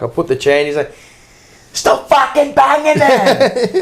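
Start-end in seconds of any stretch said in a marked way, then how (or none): 1.7-3.8 imitating Ange's scolding. Quoting Ange scolding over chairs.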